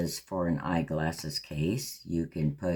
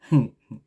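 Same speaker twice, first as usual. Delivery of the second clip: burst of laughter; 5 semitones higher, 9 dB louder than usual. Real, not acted. Laughs at her own mistake.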